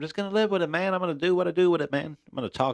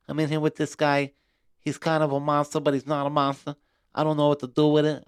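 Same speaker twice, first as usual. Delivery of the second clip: Mike Tyson impression; 6 semitones higher, 3 dB louder than usual. Host imitates Mike Tyson selling the fight, high put-on voice. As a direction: play a boxing legend hyping a fight he can't explain. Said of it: "that was my Mike Tyson impression".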